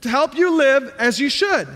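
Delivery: impassioned sermon delivery; preaching. Acted. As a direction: preach it out to the congregation. Preaching at full force.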